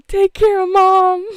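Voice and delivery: high, loud tearful plea; crying. Retold or imitated. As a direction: beg through tears, slowly. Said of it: "I was, like, crying".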